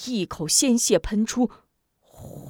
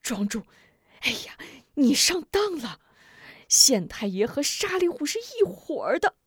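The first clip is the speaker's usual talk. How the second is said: anxious and angry; 5 semitones higher than usual. Acted. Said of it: "王六伯又气又急".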